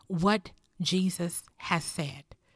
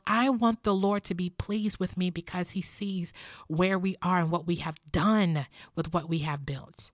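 normal talking voice

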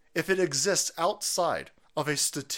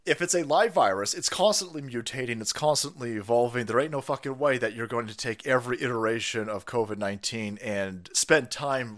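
ordinary talk, nothing marked

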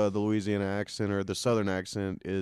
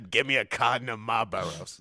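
trailer smoker woman; 3 dB quieter than usual. Retold or imitated. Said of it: "her name is Deborah and she smokes cigarettes".

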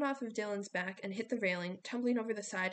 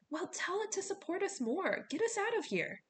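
much higher anxious demand; urgent plea; 10 semitones higher than usual. A narrator's reading. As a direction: urge him to get you to safety. Piper urging Jason, midair panic.

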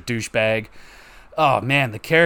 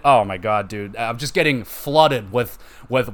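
normal talking voice

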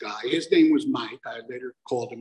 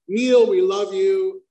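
"Neil, we love you" dragged out slowly and louder; slow emphatic mock letter; 6 semitones higher, 6 dB louder, slower than usual. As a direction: weigh each word of "Neil, we love you". Third letter opener, slow and loud.

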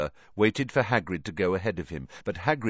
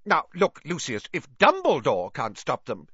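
flustered official; 8 semitones higher, 5 dB louder than usual. A narrator's reading. Fudge voiced.

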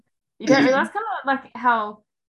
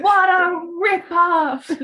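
drawn-out, higher, laughing outrage; playful indignation; 6 semitones higher, 5 dB louder, much slower than usual. Acted. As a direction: exclaim it slowly through a laugh. Playfully echoes complaint about bagel prices.

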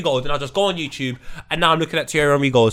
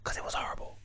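whispering; 16 dB quieter, faster than usual. Real, not acted. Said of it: "Loon's voice".

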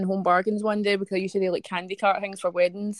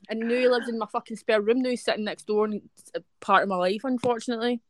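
usual speaking voice

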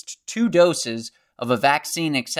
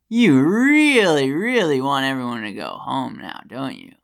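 mocking taunt; 3 dB louder than usual. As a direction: taunt them, stretching the first "really" and easing off. Taunting the officials who froze funds.

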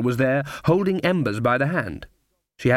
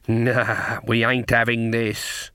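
usual speaking voice

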